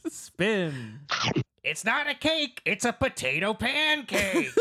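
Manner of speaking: high squawking comic voice; Gilbert Gottfried voice. Acted. Keeps the Gottfried voice going.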